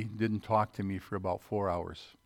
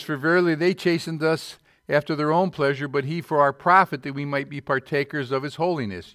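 loud, raised preacher's reading; scripture reading; 4 semitones higher, 6 dB louder than usual. Acted. Preacher reading scripture to the church, loud and raised. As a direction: declaim the verse to the congregation.

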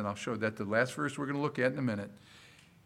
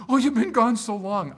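louder, higher gushing welcome; excited dog voice; 6 semitones higher, 7 dB louder than usual. Retold or imitated. Dog greeting Judy back home, gushing and loud. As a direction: gush like a dog at the door. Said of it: "and she's like".

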